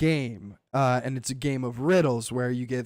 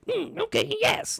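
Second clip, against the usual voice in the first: squeaky high goblin agreeing; a tiny creature's eager yes; high-pitched goblin messenger; little goblin voice. Retold or imitated. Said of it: "the goblin's like".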